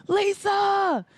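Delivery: high, loud cheering from the stands; proud relatives whooping from the bleachers; family cheering. Retold or imitated. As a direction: yell it across the pool to her.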